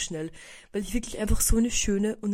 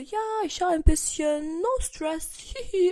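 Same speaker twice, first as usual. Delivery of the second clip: higher, put-on carefree voice with a giggle; forced breezy cheer; 7 semitones higher, slower than usual. To Hannah, hiding panic, sing-song fake calm. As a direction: pretend everything's fine.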